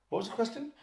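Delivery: high, soft puzzled question; mock puzzled. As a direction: imitate a player who has lost the thread. Acts out rambling player's sudden question.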